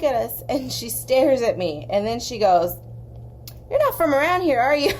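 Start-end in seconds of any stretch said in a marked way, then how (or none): 3.7-4.9 higher, louder voice for the waitress; gruff Midwestern waitress. Retold or imitated. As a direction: play the tough diner waitress sizing her up. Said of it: "big, gruff Midwestern women".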